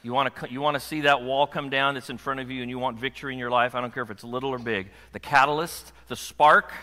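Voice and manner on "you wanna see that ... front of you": ordinary delivery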